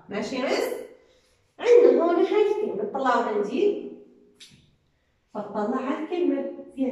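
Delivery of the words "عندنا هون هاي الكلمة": calling out. Real, not acted. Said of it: "teacher calls class attention".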